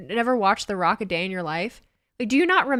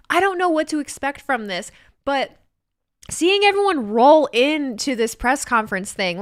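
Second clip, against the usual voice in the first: usual speaking voice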